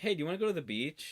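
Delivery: fast, high, breezy offer; sudden change of topic. Retold or imitated. Hong-gil dodging her question, fast and breezy. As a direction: rush the invitation out. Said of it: "And he's like".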